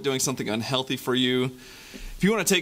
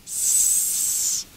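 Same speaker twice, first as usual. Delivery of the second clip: snake hiss. Acted. Snake hisses.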